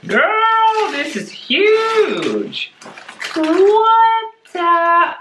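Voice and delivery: high, loud squeal of amazement; amazed squeal. Real, not acted. To the sender, shrieking over a huge plant, squealing high. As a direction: shriek at the giant plant in delight.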